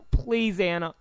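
usual speaking voice

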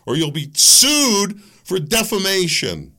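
hammed-up official threat; mock official threat. Mock official warning a speaker off, slow and loud.

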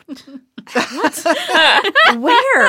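mock surprise. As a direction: play the baffled parent squinting for the spider. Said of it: "And I'm like".

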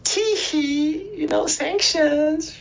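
high, far-off mocking titter, drawn out; mock giggle. Americans mocked as giggling about taking the money, high mocking titter. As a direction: giggle it like a smug kid caught out. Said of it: "the Americans were like".

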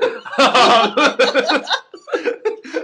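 big burst of laughter; cracking up hard; loud laughter. Real, not acted.

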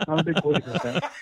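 fast, laughing, every syllable bent out of shape; mangled JBL voice. Shown as a demonstration. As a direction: rush it in a put-on announcer voice. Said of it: "no syllable sounds like what it's supposed to sound like".

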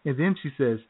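usual speaking voice

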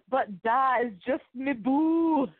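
put-on high, slow Scottish kid voice; Scottish Brave accent. Co-host riffs on the bit in a high Scottish kid voice. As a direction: lilt it like a wee Scottish boy. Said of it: "a Scottish slash Brave accent".

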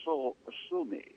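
flat, quiet monotone; deadpan and soft, no inflection. Retold or imitated. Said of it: "in his most monotone voice said".

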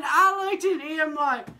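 ordinary delivery

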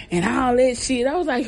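ordinary delivery

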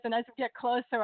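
ordinary delivery

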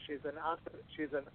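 soft, laughing stumble over a hard word; tongue-tied with laughter. Real, not acted. Host failing at the guest doctor's title, tongue-tied with laughter. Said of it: "I can't say it".

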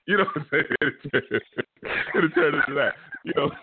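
amused, laughing speech; talking through laughter. Real, not acted. Host laughing at his own caller skit, words broken by laughter. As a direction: keep talking while cracking up.

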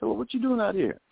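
high-pitched, eager street-encounter question; excited re-enacted question. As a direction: ask as if bumping into an idol.

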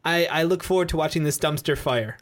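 usual speaking voice

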